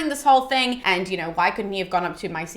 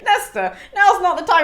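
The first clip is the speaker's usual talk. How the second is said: high, louder exasperated retort; mock protest; 8 semitones higher, 4 dB louder than usual. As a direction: cut in on the rambler, pitched up. Mock protest to rambling Nestor.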